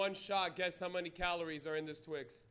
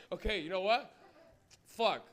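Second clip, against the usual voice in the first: ordinary talk, nothing marked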